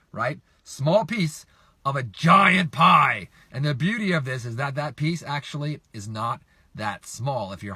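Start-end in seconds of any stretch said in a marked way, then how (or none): 0.6-3.3 slow, loud, drawn-out GIANT PIE; playfully oversized delivery; big emphatic boom. Real, not acted. Repeats giant pie with relish.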